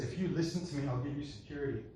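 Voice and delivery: soft, hushed voice of fear; quietly coax, as fear making promises. Acted. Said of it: "the voice of fear says".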